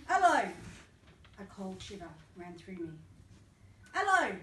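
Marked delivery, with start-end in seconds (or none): 0.1-0.5 loud high call across the room; mum calling out. Retold or imitated. Mum shouting for her son in front of the class, loud and high. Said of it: "she shouted". 3.9-4.3 loud high call across the room; mum calling out. Retold or imitated. Mum shouting for her son in front of the class, loud and high. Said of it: "she shouted".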